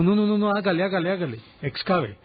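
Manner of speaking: high, insistent retold orders; eager urging. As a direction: act out urging the foreman to keep going. Retelling Carter urging the foreman.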